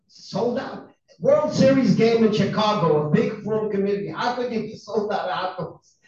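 retold indignant challenge to the news; incredulous protest. Customer confronting sold-out hot dog vendor, incredulous protest.

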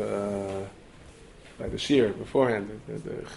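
ordinary talk

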